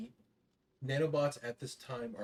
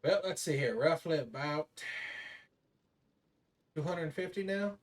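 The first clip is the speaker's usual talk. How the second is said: gruff little voice; 6 semitones higher, 4 dB louder than usual. Acted. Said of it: "pull up a manifest and goes".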